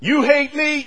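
ordinary talk, nothing marked

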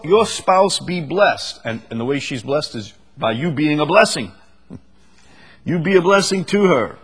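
usual speaking voice

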